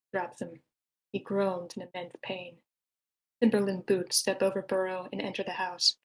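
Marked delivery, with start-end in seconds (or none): none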